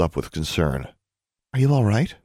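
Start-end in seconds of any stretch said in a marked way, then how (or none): 1.5-2.1 higher-pitched concerned question. A narrator's reading. Roommate with wrinkled brow checks on friend, concerned. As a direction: ask with mild concern, pitched up.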